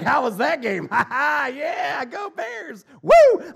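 loud, high gloating shout with a laugh; mock gloating taunt. Packers fan taunting a Bears fan, gloating shout. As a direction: rub the loss in like a rival fan. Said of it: "like seeing a Bears fan".

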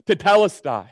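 a full, loud cry ringing out. Acted. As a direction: call it out loud in triumph. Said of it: "Jesus cried out with a loud voice".

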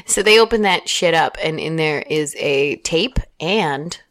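ordinary talk

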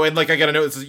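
normal talking voice

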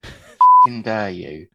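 loud, drawn-out indignation; hammed-up affronted outburst; mock outrage. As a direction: play mortally insulted, stretching each word. Acted offence at being called Welsh.

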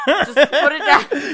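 high, loud, slow Scottish voice; a Scottish accent through a laugh. Pretend. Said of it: "You were for a minute".

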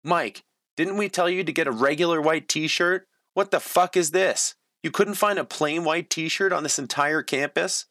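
high furious scolding; screaming. A narrator's reading. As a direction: screech at him in disbelief.

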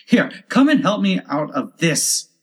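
high, cross rabbit's order; angry. A narrator's reading. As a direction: demand help angrily. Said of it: "said the rabbit angrily".

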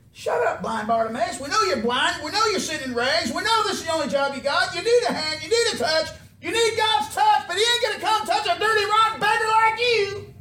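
high, loud scolding, building to a shout; scornful rebuke. Acted. Crowd rebukes the blind beggar.

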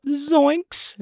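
very high cartoon yelp; Shaggy. Imitating an actor peeking round a banner, high cartoon voice. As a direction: squeal it like a spooked cartoon character. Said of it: "he's doing the Shaggy voice".

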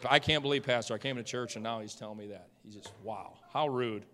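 lightly voiced, low and quiet grumble; mock indignation, kept under the breath; offended churchgoer. Preacher imitates offended member reacting to sermon, quiet indignation.

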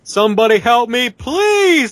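high, loud desperate plea for help; terrified horror victim. Acted. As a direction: beg for rescue, panicked. Lost, pleading for help.

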